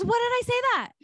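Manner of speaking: very high, baffled question; high-pitched mock disbelief; retold bewilderment. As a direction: imitate someone astonished at their own words. Friend repeats her amazed question.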